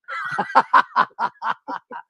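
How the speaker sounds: laughing at his own gag; big warm laugh at himself; hearty laugh. Real, not acted. Comedian laughing at his own email joke.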